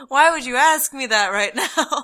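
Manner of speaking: slow, loud, mock-appalled question; imitating shocked indignation. Coworker caught faking an accent to a customer, mock shocked. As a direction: retell it aghast, with a laugh underneath. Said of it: "he was just shocked".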